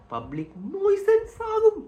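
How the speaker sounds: high-pitched drawn-out shout; too loud. Shown as a demonstration. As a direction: blast it out as a public nuisance. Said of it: "ഒത്തിരി ഒച്ചയിൽ സംസാരിച്ചു".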